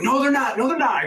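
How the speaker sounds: high, heated contradiction; retold argument, flatly refusing to accept it; insistent denial. Retold quarrel over closing time.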